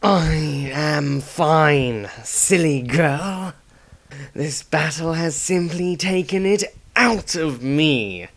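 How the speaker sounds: put-on slow, gruff old man waving off concern; Dieter's voice. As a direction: hide the pain, slowly and stubbornly. Dieter brushes off his pain.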